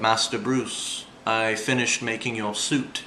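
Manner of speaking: a hint of a low, slow, soft-spoken butler; slow and hushed, like a mild old manservant; lightly voiced butler. Butler announcing the finished suit to his master, lightly voiced and slow. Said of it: "it was like".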